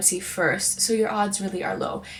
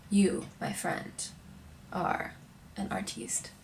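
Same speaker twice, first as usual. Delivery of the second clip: slow, then drop to a whispered artiste; mock grand pronouncement; 7 dB quieter than usual. Telling the viewer they are an artiste, mock grandly whispered. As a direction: whisper artiste like a secret honour.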